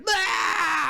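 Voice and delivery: high yelp of fright; shriek, high and sudden; mock startled scream. People startled by a camera, mock scream.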